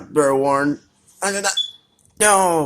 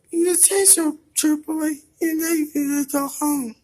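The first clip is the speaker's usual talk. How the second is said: high-pitched teasing cartoon voice; squeaky character voice; 13 semitones higher than usual. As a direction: tease like a tiny pet. Voices character answering back.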